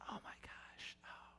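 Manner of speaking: whispered realisation, hammed-up; a sudden hushed gasp of mock panic; mock panicked whisper. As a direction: gasp it under your breath in mock alarm. God voiced in mock panic.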